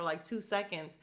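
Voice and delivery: usual speaking voice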